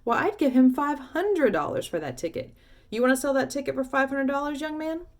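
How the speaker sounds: loud bidding. Acted. Woman outbids loudly.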